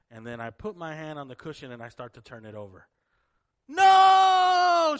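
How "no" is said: long, high, loud wail of refusal; crying child's shout. Retold or imitated. Girl seeing the cushion turned over, crying shout. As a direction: scream it like a panicked little girl.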